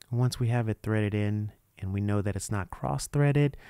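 usual speaking voice

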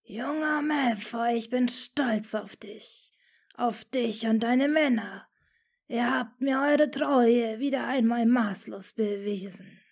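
slow, cold, unvoiced hiss of a whisper; icy whispered menace from a feared lord; cold hissing Dark Lord. A narrator's reading. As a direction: hiss it like a cruel serpentine master. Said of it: "klirrte die schmerzende Stimme kalt".

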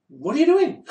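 high, disbelieving question; incredulous. Acted. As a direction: ask it in total disbelief. Incredulous challenge again.

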